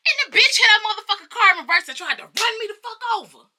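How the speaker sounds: high, loud, outraged storytelling; outraged outburst. Retold or imitated. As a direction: blurt it out high and loud in disbelief. Outraged retelling of near hit.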